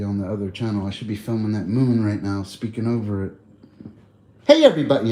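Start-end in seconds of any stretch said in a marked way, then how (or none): 4.5-5.1 louder, higher presenter greeting; TV host voice. Shown as a demonstration. Streamer demoing his narrator voice for the moon, louder bright greeting. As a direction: greet like a science channel host. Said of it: "I gotta switch my voice".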